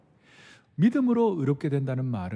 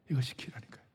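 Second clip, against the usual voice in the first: whisper; 2 semitones lower, 9 dB quieter, faster than usual. Real, not acted. Emphasising the key point.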